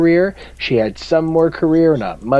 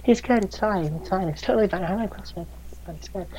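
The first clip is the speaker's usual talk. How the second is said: higher, softer, noncommittal voice; mock focus group member; 6 semitones higher, 6 dB quieter than usual. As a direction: act like a bored test viewer. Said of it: "everybody's like".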